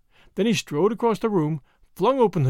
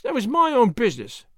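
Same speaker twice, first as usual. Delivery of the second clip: higher, mildly defiant servant voice; sullen; 5 semitones higher than usual. A narrator's reading. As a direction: answer back with sulky resentment. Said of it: "said the woman sullenly".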